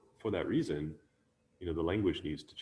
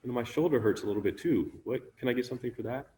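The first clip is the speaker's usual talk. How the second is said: lightly voiced student, pitched higher; complaining student; 6 semitones higher, 5 dB louder, faster than usual. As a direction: mildly plead like a student with a small complaint. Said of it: "us as students go".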